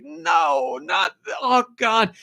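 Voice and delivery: high, pained wail of denial; hammed-up heartbroken no; anguished cry. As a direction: plead against the story in anguish. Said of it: "and you're like".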